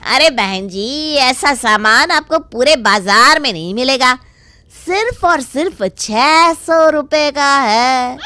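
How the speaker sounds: shopkeeper luring a customer; pushy shopkeeper. Acted. Shopkeeper pitches to Sudha. (दुकानदार का लुभाता अंदाज़)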